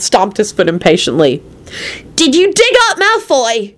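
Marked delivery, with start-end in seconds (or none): 2.2-3.7 high, drawn-out accusing cry; accusing shout. A narrator's reading. Timothy confronting Quentin about the dig, accusing shout. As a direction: demand it accusingly, high and slow. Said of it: "he shouted accusingly at Quentin".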